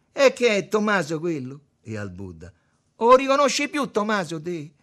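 high, loud teasing; loud mocking question. A narrator's reading. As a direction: ask it loudly to tease him. Lo sciacallo sfotte Tommaso. (domanda gridata per sfottere)

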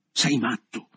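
loud, forceful exclamation; forceful outburst. A narrator's reading. Man to the boy he has just freed, forceful. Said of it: "proferì alfine con forza".

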